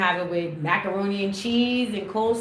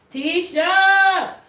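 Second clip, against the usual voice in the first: loud high call across the house; 10 semitones higher, 8 dB louder than usual. Real, not acted. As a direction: shout the name to summon her. Calling someone off camera.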